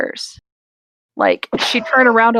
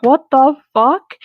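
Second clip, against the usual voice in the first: slow, louder stunned disbelief; shocked; 4 semitones higher, 4 dB louder than usual. Retold or imitated. Spotting the bat in the lamp, slow stunned disbelief. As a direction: drag it out, stunned at the shape growing. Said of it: "I'm like".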